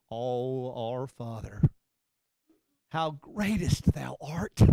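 low, soft, slow put-on pious prayer; mock holy voice. Mocking people who pray in King James style, low and pious. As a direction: put on a lofty churchy tone. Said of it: "get all holy on him".

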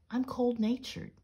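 high little squeak. A narrator's reading. The guinea pig explains itself to Peter, squeaking. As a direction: lift it into a mild squeak. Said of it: "squeaked the guinea pig".

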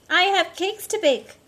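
high, loud and chirpy baker; cheerful baker voice. Acted. Reading to kids, a busy baker announces her cakes, high and loud. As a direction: call it out like a busy baker glad of the sun.

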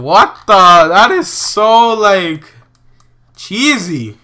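ordinary talk

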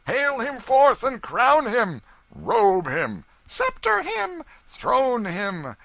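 high, loud, rowdy calls; crowd cries. A narrator's reading. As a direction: cry out orders from a jeering mob. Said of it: "twenty other cries broke out at once".

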